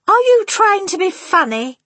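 high, loud prim wife; prim indignation. A narrator's reading. Missus Jenkins affronted by grandmother, prim and high. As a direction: take offence in a high, prim voice. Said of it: "very prim".

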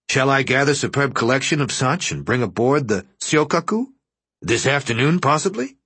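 a dealer keen to please a buyer; eager dealer. A narrator's reading. The shopkeeper answers the admiral's man eagerly.

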